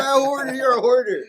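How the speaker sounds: high, loud accusing shout; retelling mom yelling at him; mocking accusation. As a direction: call him out loudly as a hoarder.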